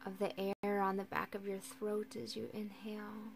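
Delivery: calm, unhurried guiding with long silences; guided meditation. Acted. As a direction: ease listeners into rest, leaving space for each breath.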